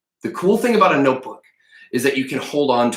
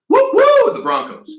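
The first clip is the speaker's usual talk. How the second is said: much higher, loud woo woo; cheer; 5 dB louder than usual. Real, not acted. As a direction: whoop for the team. Cheering his school team.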